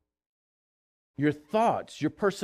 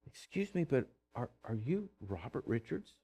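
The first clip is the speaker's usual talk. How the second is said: much softer, polite question; a hint of a young cashier; 5 semitones lower, 15 dB quieter than usual. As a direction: lightly voice the shy girl asking. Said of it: "the little girl behind the cash register said".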